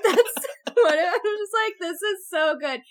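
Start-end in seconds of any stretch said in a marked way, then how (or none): none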